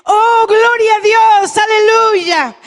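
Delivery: imitación de un grito de júbilo, alto y alargado; grito llorado imitado. Retold or imitated. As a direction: imita a una mujer que clama llorando, a plena voz. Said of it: "comienza a llorar, a gritar y a decir". (grito de alabanza entre llanto, imitado)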